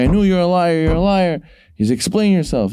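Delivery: high, heated mock accusation from the king; hammed-up angry king calling his treasurer a liar; king accusing his treasurer, in a high, heated mock rage.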